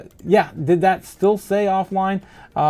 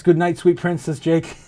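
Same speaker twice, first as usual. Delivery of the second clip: ordinary talk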